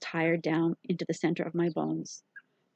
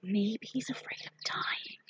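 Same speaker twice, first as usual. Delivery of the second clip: slow, soft unvoiced whisper; little girl whispering a frightening guess, slow hush; 3 semitones higher, 8 dB quieter, slower than usual. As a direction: breathe it out slowly like a secret. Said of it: "Jessie's voice got hushed and whispery".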